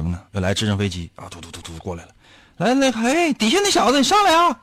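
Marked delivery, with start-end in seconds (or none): 2.6-4.6 high calling out from the helicopter; hammed-up rescuer yelling; mock rescuer call. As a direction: shout down to the man in the water.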